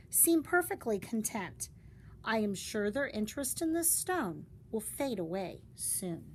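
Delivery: usual speaking voice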